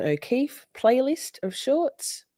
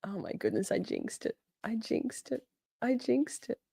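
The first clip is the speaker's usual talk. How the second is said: very soft, rueful murmur; hushed self-blame; dismayed; 5 semitones higher, 10 dB quieter than usual. Real, not acted. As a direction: say it small, to yourself. Rues the glitch after boasting.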